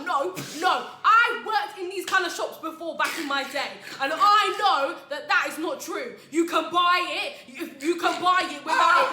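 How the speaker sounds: high, slow, booming protest; acting out a worked-up customer; loud, insistent arguing. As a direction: argue it loudly, refusing to let go. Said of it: "he was not letting it go".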